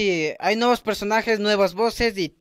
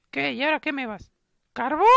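put-on high falsetto girl's voice; cartoonish girly falsetto; unimpressed girl friend; 8 semitones higher than usual. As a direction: query the gift in squeaky disbelief. Girl receives coal.

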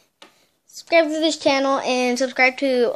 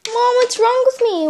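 much higher and louder plea to Mom; panicked call; 10 semitones higher, 6 dB louder than usual. Acted. Daughter calling to mom, loud panicked plea. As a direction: cry out for help.

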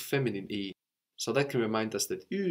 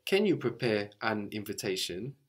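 normal talking voice

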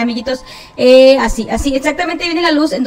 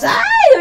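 scared shriek; 3 dB louder than usual. Real, not acted. Sudden scare in chase game.

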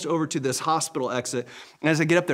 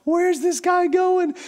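high, slow, mock puzzled bystander voice; 5 dB louder, slower than usual. Hospital bystanders watching a man sprint with a wheelchair, high mock bafflement. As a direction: play a baffled onlooker, high and drawn out. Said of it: "all these people are going".

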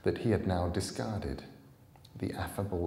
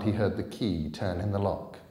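usual speaking voice

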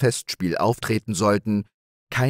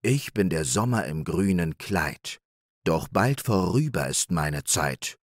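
plain reading voice

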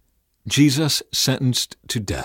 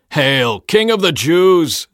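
loud, slow mock salute; 7 semitones higher, 8 dB louder, slower than usual. A narrator's reading. Soldiers taunting their crowned prisoner, mocking cry. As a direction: hail him with drawn-out scorn. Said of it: "they mocked".

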